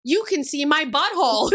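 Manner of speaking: put-on high, loud little girl; little daughter voice. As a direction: play the giggly small daughter. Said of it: "And she goes".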